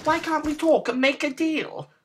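very high squealed pleading; squealing little pig. A narrator's reading. Pig begging the wolf for a deal, high squeal. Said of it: "The little pig began to squeal".

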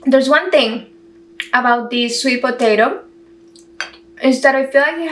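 fingers at lips; eating while talking; mouth full chewing. Real, not acted. Seen 5: Fingers at lips mid-chew until 0.9, then hand lowers.

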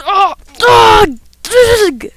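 loud, high, exasperated ughs; frustrated yelled grunts. Real, not acted. Gamer failing a jump, frustrated yelled grunts. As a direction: burst out in real exasperation.

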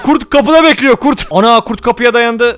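ordinary talk

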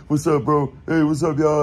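mock the breezy hello; lightly voiced casual dude greeting; imitating the pool guy. Mimicking guy greeting in prison.

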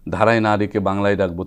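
ordinary talk, nothing marked

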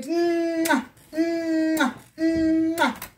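loud smacking kisses, high; blown kisses. Real, not acted. Vlogger blows smacking kisses to her viewers. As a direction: blow kisses at the camera.

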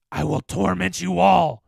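loud, strained monster threat; menacing demon voice; vengeful specter. A narrator's reading. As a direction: roar a curse from captivity.

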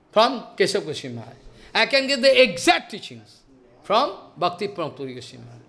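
ordinary delivery

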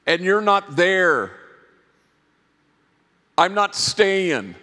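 tearful vow. Retold or imitated. Promising Letty across the desk, choked-up earnest vow. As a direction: swear it through tears, slowing at the end.

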